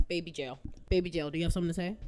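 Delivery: fast, cooing question to the cat; quick doting chat with the cat; pet talk. Real, not acted. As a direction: coo at the pet. Talking to her cat.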